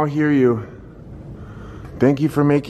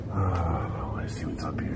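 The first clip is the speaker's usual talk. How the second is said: soft, hushed whisper; 8 semitones lower, 11 dB quieter than usual. Real, not acted. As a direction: whisper so nothing hears you. Creeping into a dark attic.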